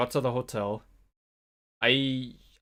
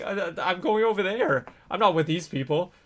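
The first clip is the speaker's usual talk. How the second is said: high, louder stammering excuse, acted out; flustered protest; 8 semitones higher, 5 dB louder than usual. Student explains to the bus driver, comically flustered. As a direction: protest in a raised, mock-flustered voice.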